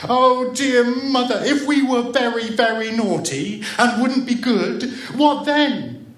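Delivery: put-on high, loud childish wailing; sobbing. A narrator's reading. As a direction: wail to mother in acted distress. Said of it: "she sobbed".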